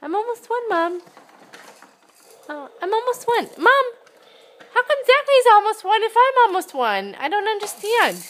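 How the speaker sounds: put-on high squeaky baby voice. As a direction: play the confused baby. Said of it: "mother voices baby's reply".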